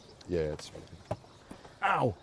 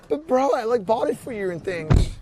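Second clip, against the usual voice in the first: much higher, wounded "but bro" whine; whiny hurt friend; 10 semitones higher, 5 dB louder than usual. Retold or imitated. Friend mocking himself after a rejected gift, high whine. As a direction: play the hurt gift-giver, pitch way up. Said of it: "I'll be like".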